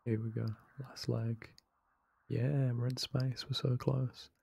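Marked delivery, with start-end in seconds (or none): none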